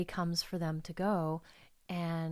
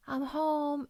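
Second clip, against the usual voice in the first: high, lightly raised homecoming call; talking out loud; 8 semitones higher than usual. Retold or imitated. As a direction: announce yourself to an empty house in a lifted voice. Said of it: "just like, talking out loud".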